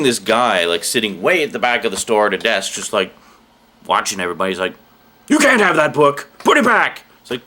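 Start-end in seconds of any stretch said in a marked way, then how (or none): 5.3-7.0 high, loud, bossy man; stern gatekeeper voice. Retold or imitated. Imagined back-of-store man scolding a customer, bossy and loud.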